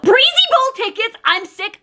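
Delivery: real giddy excitement; excited squeal. Real, not acted. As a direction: squeal the words in disbelief. Gushing over surprise gift.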